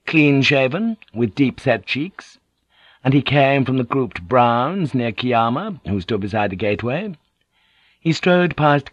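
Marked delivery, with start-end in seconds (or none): none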